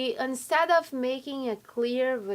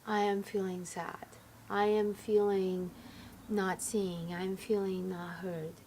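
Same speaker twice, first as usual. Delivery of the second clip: soft, slow confession of feelings; calm, vulnerable sharing; 3 semitones lower, 5 dB quieter than usual. Acted. Partner sharing hurt feelings safely, soft and sincere. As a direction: go inside and share it gently. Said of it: "there is a better way to communicate".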